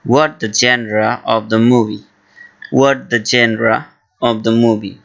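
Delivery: very slow, careful English; slow model phrase. Shown as a demonstration. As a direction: say it slowly for learners. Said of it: "ইংলিশে বলবেন". (ধীরে ধীরে স্পষ্ট উচ্চারণে)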